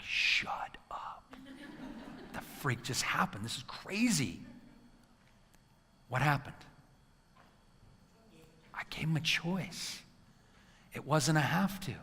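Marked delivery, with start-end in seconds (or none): 0.0-1.4 whispered in shocked disbelief; 1 stunned whisper. Real, not acted. Parent amazed the kids left, whispered disbelief. 8.7-12.0 1 awed whisper. Real, not acted. Awed realization.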